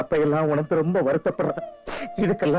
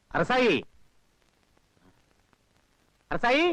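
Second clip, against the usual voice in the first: Arasayi called out much higher, rising; calling out; 7 semitones higher, slower than usual. Acted. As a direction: shout Arasayi across the fields. Father searching for daughter.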